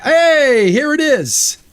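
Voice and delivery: sudden high, loud cry of discovery; excited discovery. Real, not acted. Streamer spotting his lost ship, high excited yelp. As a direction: yelp as the ship finally appears.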